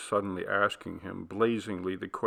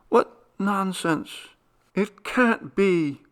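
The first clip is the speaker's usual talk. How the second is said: higher, slow pleading; wife refusing her husband's fate; desperate denial; 9 semitones higher, 4 dB louder, slower than usual. A narrator's reading. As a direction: beg it not to be true. She continues her cry.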